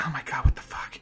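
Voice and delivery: shocked whisper. Retold or imitated. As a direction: breathe it out in disbelief. Girls quietly shocked.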